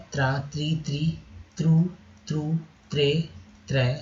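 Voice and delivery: ordinary talk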